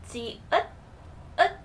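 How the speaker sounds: ji and ut split into syllables; ut with T ending. Shown as a demonstration. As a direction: sound out ut, stopping on the T. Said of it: "pronounced as the T sound".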